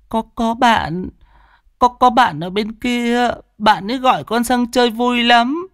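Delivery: put-on high, halting child's voice; high stammering child's voice; tearful little boy. A narrator's reading. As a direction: stammer like a crying small boy. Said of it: "Thằng cu mếu máo khóc".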